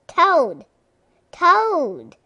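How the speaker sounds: loud high calling shout; loud shout of a friend's name. A narrator's reading. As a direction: call out for a friend at the door. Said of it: "shouted Frog".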